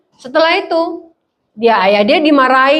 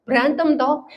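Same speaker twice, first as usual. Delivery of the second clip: normal talking voice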